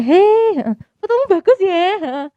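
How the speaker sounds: high, loud, cheerful greeting; bright sincere compliment. Retold or imitated. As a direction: genuinely praise the photo. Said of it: "Ketemu temanku setelah itu".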